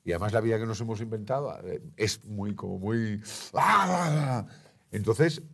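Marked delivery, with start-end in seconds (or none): 3.5-4.5 loud, high drawn-out "aah"; overwhelmed cry. Retold or imitated. Guest mimics how frantic modern life feels, overwhelmed cry. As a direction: cry out as if swamped by it all.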